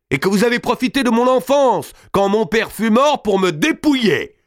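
high, loud rising indignation; angry accusation. A narrator's reading. Robert accuses his aunt of robbing him, high and loud. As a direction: hurl the charge at her.

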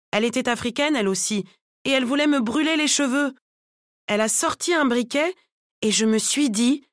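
lively, worked-up salon anecdote; indignant client. Acted. Client recounting the lighter incident to the braiders, indignant.

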